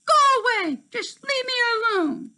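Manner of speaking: high loud shout of rage; angry shout. A narrator's reading. Tink driving Terrence away, loud angry shout. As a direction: yell him out of the room.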